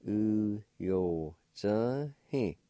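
"U yo zhe he" stretched very slowly; drawn out so a learner can copy it; very slow demo. Shown as a demonstration. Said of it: "Pronounced like this".

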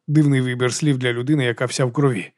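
ordinary delivery, nothing marked

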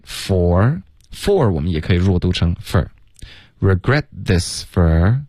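"for" reduced to a short "fur"; weak for. Shown as a demonstration. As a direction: say "for" as a quick unstressed "fur". (把"for"弱读成"fur")

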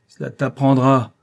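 stern scolding. A narrator's reading. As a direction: tell off the punished pupil. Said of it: "prononça Pauluche".

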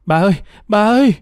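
high, quick, panicked cry for grandma; boy's frantic call, narrated; acted scream. A narrator's reading. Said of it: "Nam hét lên".

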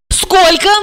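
high, menacing demand; sharp female ultimatum; threatening question. A narrator's reading. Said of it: "с угрозой спросила Регина".

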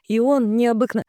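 dreamy gush broken off; cut off mid word. A narrator's reading. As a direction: stop dead mid word. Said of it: "оборвал меня на полуслове". (обрыв на полуслове)

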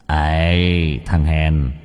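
lightly voiced taunting shadow, low; slow, low call from an inner villain; sinister shadow. A narrator's reading. (giọng cái bóng ác tâm khiêu khích)

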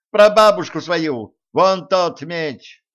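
high, loud, irritated comeback; exasperated retort. A narrator's reading. Zhenka answers Elisei's silly question, exasperated. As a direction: snap back loudly, losing patience.

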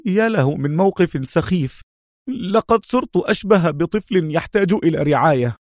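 high, frustrated complaint; tense Khaled. A narrator's reading. Injured Khaled leaning on Adham, tense and high. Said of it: "وقال في توتر".

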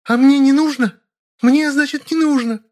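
high, loud, wounded female voice; hysterical wailing. A narrator's reading. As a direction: demand indignantly in shrieky falsetto. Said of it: "заголосила". (истерично голося, высоким голосом)

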